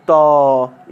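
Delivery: त said alone, loud, with the short a ringing out; sounding the final a. Shown as a demonstration. Teacher loudly sounds out त for students. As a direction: hit त hard with its closing a. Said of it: "इस सब में जो है अ का उच्चारण हो रहा है".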